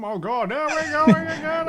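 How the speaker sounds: high-pitched goofy voice, loud; silly voice. Pretend. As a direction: keep the goofy voice going. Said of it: "I've gone into a silly voice".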